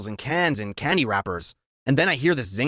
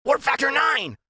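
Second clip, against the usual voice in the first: shouting; 12 semitones higher than usual. A narrator's reading. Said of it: "Freak is shouting".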